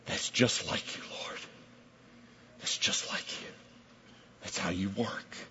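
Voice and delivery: hushed, barely voiced reply; very soft, intimate acceptance; whispered prayer. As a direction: whisper it to the Lord.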